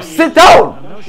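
high, insistent command; sharp high-pitched order; exasperated order. Real, not acted. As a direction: order him down, losing patience. Ordering benched player to sit.

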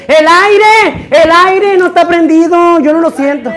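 loud, high shout about the air; shouting complaint. Real, not acted. Complaining loudly to the crew that the air is off, shouting. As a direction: yell that the air is off.

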